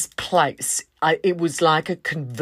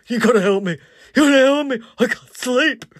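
high, louder parody of a begging man; mocking imitation; 7 semitones higher, 5 dB louder, faster than usual. Friends laughing at Jay, high mock pleading. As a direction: mimic his plea for laughs. Rachel and I just laughing and going.

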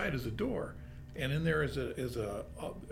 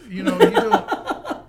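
high, slow laughing protest; 15 dB louder, much slower than usual. Real, not acted. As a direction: talk through laughter.

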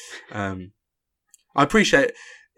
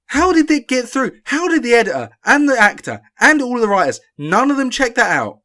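much higher and louder, rising disbelief; incredulous outrage; 11 semitones higher, 7 dB louder than usual. Real, not acted. Host venting at the filmmakers over a map error, incredulous outrage. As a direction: demand to know how nobody caught it. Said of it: "that's the end of my rant about London".

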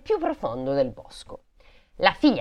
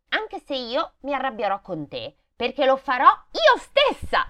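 put-on threatening little girl; 7 semitones higher than usual. A narrator's reading. Brigand's daughter warning Gerda she'll do it herself, threatening. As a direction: deliver a child's threat, nearly laughing.

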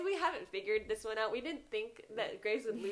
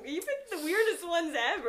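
laughing; 8 semitones higher, 8 dB louder than usual. Real, not acted. Teasing friend, laughing.

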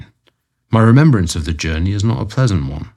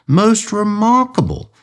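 slow, much higher, far-off voice; distant voice; 13 semitones higher, slower than usual. A narrator's reading. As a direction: sound far away down the line. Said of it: "said the distant voice".

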